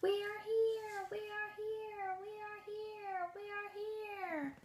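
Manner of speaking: high and faint tiny Who voices; best Who voices. Shown as a demonstration. Class doing Who voices for Horton, high and faint. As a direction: squeak it out as a crowd of tiny Whos. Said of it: "in their very best Who voices to shout".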